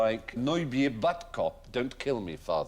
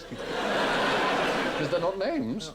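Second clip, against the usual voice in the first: drawn out; 7 semitones higher, 3 dB louder, much slower than usual. Acted. Stretching out the answer.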